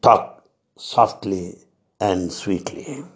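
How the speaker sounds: slow, hushed and gentle; slow tender quote, trailing quiet; soft and sweet. Retold or imitated. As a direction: let it fade softly as a loving reminder. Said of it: "let's remind every time what Baba said".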